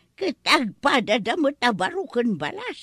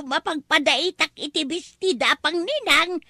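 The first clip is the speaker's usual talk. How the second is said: ordinary talk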